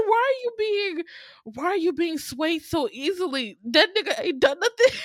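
high-pitched, indignant pleading; exasperated mock scolding. Viewer scolding Rita for being swayed, exasperated.

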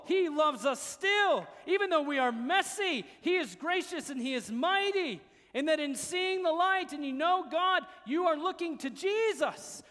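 high, loud, impassioned sermon delivery; full-force preaching. Real, not acted. Preacher assuring sinners of grace in Jesus, full-force preaching. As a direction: proclaim it with mounting conviction.

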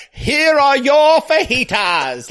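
high, drawn-out announcing of the dish; showy server presenting. Acted. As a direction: present it with hammed-up fanfare. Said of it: "where they come out like".

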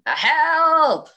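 high mock cry of Help, held back; toned-down scream for help. Retelling her fall, a held-back high cry for help. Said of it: "That's not how it was. I won't reenact it".

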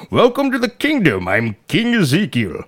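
slow, swooping mock-royal proclamation; King Ezekiel. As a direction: draw it out like a pompous storybook king. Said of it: "some guy starts talking to me like that".

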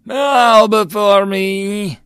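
booming, slow, high god-emperor voice; dark lord. A narrator's reading. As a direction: proclaim it slowly like a tyrant god. Emperor god proclaims from sky.

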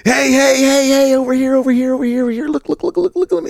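very high, calling hey over here; voicing a duck calling. Shown as a demonstration. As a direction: plead high like a calling hen. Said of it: "It's like".

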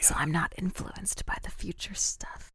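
hushed whisper. Real, not acted. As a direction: drop to a whisper. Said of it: "Why are we whispering?".

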